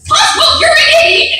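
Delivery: loud, very high cartoon scolding; Wanda. Shown as a demonstration. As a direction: scold shrilly in a squeaky cartoon voice. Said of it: "to Cosmo".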